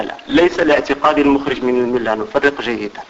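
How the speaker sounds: ordinary talk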